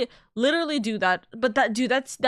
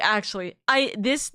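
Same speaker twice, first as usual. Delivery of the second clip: ordinary delivery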